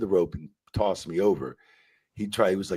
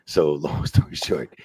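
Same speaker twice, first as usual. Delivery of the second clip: ordinary delivery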